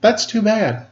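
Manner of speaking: lightly voiced mother. A narrator's reading. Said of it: "my mother said".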